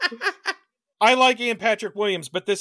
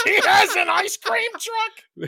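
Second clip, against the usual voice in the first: high, slow disbelieving laugh; incredulous laughing; 6 dB louder, slower than usual. Real, not acted. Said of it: "one of my notes in all bold".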